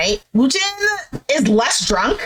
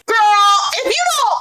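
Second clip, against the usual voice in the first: shocked viewer shouting at the show; outraged outburst; 9 semitones higher than usual. Real, not acted. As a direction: yell it like a fan who cannot believe what she just heard. Viewer's own outburst at the drama.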